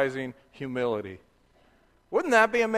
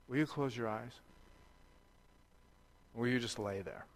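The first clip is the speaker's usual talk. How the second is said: much softer, gentle instructions to lie still; soft calming request; 7 semitones lower, 12 dB quieter, faster than usual. Retold or imitated. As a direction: hush the child down to the floor. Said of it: "sometimes I've said".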